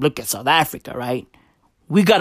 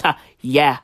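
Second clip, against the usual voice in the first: normal talking voice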